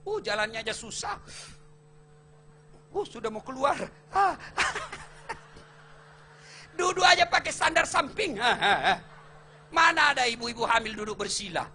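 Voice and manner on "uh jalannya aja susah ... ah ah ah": mock pregnant strain. Imitates pregnant wife struggling.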